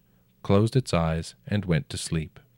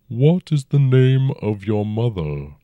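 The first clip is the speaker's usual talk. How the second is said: dragon voice; 4 semitones higher, 6 dB louder than usual. A narrator's reading. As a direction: speak as a huge old dragon, unhurried. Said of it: "the dragon said".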